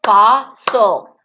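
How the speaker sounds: "Fossil" in two separate, emphatic beats; deliberate syllable-by-syllable "Fossil"; clapped-out syllables. Shown as a demonstration. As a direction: split "Fossil" slowly, one clap per syllable. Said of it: "Let's try clapping it".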